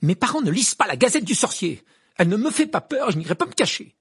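high, loud outburst; defiant anger. Acted. Hermione insists to Ron she is unafraid, angrily defiant. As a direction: declare it fiercely, without backing down.

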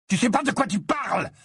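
high, heated warning; angry growled retort. A narrator's reading. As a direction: snap back in fury. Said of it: "gronda Ron".

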